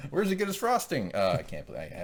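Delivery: usual speaking voice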